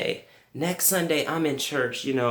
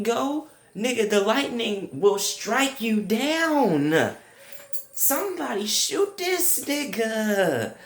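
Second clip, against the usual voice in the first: outraged rant; 6 semitones higher than usual. Retold or imitated. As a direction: rant in mock outrage, voice pitched up. Outrage at thief being grandson.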